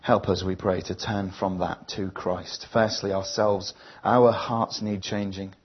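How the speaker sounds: slow, earnest prayer read line by line. Acted. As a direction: pray it slowly, leaving room after each line. Leads the congregation in prayer.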